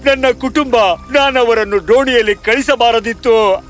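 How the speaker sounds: high-pitched theatrical sobbing; weeping. Acted. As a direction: wail with regret, sobbing before and after. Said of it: "ಕುಸಿದು ಅಳತೊಡಗಿದ". (ಗೋಳಾಡಿ ಅಳುವ ವ್ಯಾಪಾರಿ)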